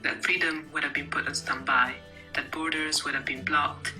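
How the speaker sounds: usual speaking voice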